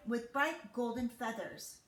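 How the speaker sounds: ordinary delivery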